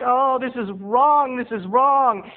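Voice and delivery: Kathy panicking after the fart, high and slow; guilty girl. Retold or imitated. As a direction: wail it like a guilty teen girl. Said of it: "And she's like".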